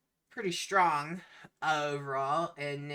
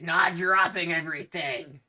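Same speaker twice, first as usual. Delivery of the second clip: loud, raised captain voice; captain's yell; 4 semitones higher, 11 dB louder than usual. Acted. Captain answering a crewman who asked what to do, yelled. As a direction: call it out across the deck. Captain calls orders to crew.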